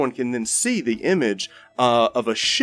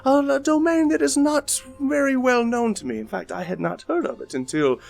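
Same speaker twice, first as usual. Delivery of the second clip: grand, pitched-up storyteller voice; theatrical bard; 5 semitones higher than usual. Acted. Bard Marcus telling the crowd a tale of dread, grandly theatrical. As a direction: spin the dread tale like a showman.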